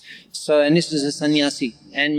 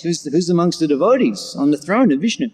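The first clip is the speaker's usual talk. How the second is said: ordinary delivery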